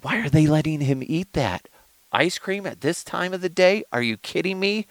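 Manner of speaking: high-pitched scandalized disbelief; mock outrage at someone else's parenting; judgmental parent. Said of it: "judge the shit out of those parents".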